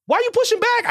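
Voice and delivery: high, incredulous challenge; mock exasperated squeak. Pushing back on Tom Cruise doubters, high mock exasperation. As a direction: jump way up in mock disbelief. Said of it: "I go".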